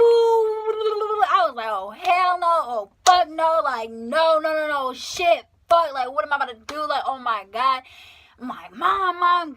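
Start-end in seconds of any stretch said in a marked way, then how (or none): 1.7-7.8 loud, high-pitched retold panic; going crazy. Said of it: "I was just going crazy". 8.7-9.5 loud, high call for mom; retold crying out.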